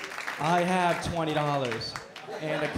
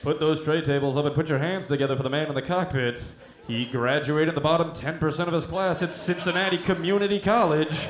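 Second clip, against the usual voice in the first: loud hype-man intro for a pilot; pilot introduction; 7 dB louder than usual. Pretend. Flight crew introduces pilot to passengers, loud announcer hype. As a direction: announce the pilot like a boxer entering the ring. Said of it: "be like".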